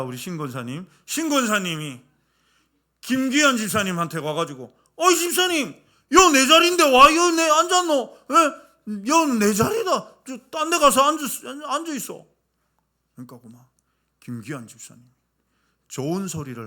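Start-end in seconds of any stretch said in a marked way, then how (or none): 5.0-12.2 put-on high, loud, brusque old-lady dialect voice; bossy old deaconess. Deaconess shooing deacon off her pew, loud and bossy. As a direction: shoo him off her seat.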